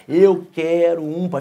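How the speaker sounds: imitating a child in slow motion, every word stretched; exaggerated slow speech. Family copying the slow nephew at breakfast, very slowly. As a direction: drag out each word deliberately. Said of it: "Devagar, do jeito dele".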